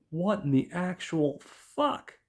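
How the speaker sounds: slow, stunned mock disbelief, pitch a little up; mock shock. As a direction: play him frozen in shock as he stares at the bathtub. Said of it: "he's just in absolute shock".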